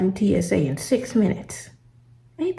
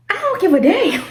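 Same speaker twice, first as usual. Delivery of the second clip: outraged retort; 11 semitones higher than usual. Retold or imitated. Retells snapping at gate agent.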